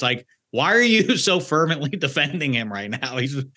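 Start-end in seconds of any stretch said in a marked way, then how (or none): none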